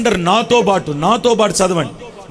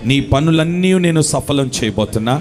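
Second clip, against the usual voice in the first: God's tender voice; 8 semitones lower than usual. Retold or imitated. God speaking to his weeping child, low and tender. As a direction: hush a crying child as a loving father. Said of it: "ఈరోజు నీకు మాట ఇచ్చినాడు".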